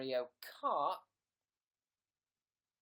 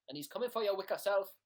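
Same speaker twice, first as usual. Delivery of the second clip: fast mock warning; 5 semitones higher, 5 dB louder, faster than usual. Spooky warning to viewers.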